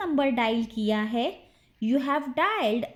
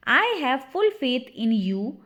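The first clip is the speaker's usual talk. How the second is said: ordinary delivery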